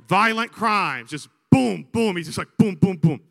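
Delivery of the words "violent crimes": slow, loud punch on violent crimes; hammering the list. Retold or imitated. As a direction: land it like a blow. Listing the state's failings.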